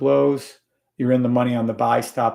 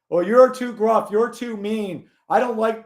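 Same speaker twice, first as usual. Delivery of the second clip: higher-pitched mock whining; mock their complaint in a higher voice; mocking complainer; 7 semitones higher, 3 dB louder than usual. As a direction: parrot a complaining viewer, pitched up. Mocking viewers' complaints, acted quote.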